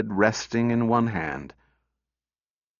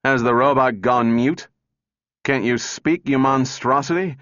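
loud, raised, exasperated demand; angry outburst; 5 semitones higher, 5 dB louder than usual. A narrator's reading. Bogart rails at the silent robot, loud and angry. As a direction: blurt it out at the mute machine. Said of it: "blurted Bogart".

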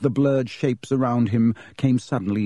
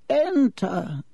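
put-on high, thin old man's voice; a frail headmaster's call; feeble old wizard; 12 semitones higher than usual. Old headmaster answering a knock, feeble high voice. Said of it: "said the old wizard in a feeble voice".

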